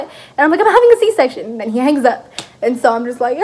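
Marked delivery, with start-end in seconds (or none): 0.6-1.4 high, loud, urgent retort; panicked insistence. Retold or imitated. Mother-to-be repeating her news to her boyfriend, panicked.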